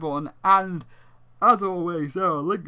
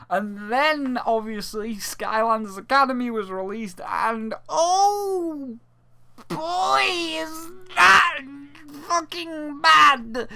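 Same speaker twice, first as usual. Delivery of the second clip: high, loud wail of disbelief; high-pitched drawn-out exasperated yell; exasperated outburst; 13 semitones higher, 4 dB louder, slower than usual. Real, not acted. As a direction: cry out in despair at how bad it is, dragging the last words. Exasperated rant about a terrible show.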